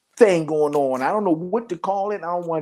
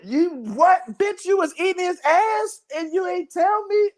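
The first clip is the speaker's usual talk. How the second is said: high-pitched incredulous outburst; shocked confrontation; 12 semitones higher than usual. Acted. Confronting a partner about a secret, high incredulous outrage. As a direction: play up scandalized disbelief, voice shooting up. Acted confrontation over the revelation.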